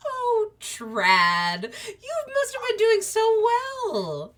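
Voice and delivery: high, slow, tearful mother's praise; tender proud mother. Acted. Fairy mother praising her rat son tenderly. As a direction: coo over her little rat with pride.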